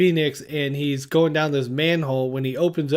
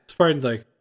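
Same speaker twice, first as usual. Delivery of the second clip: ordinary talk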